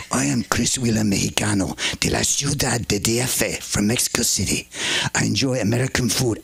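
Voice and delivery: mock Mexican guy, relaxed and sly; comic Mexican accent, understated. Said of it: "So we'll do a Chris Wheeler Mexican".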